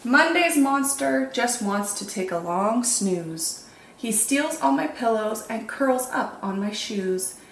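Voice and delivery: ordinary delivery, nothing marked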